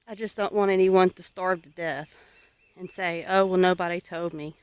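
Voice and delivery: ordinary talk, nothing marked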